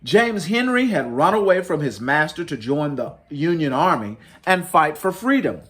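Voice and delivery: ordinary delivery, nothing marked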